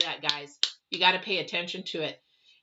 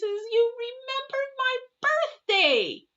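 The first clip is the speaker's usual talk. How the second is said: high, slow, sing-song delight; overjoyed aunt; slower than usual. Retold or imitated. Aunt thrilled the niece remembered her birthday, high and slow. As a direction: gush it way up high like a touched old lady. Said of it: "She says".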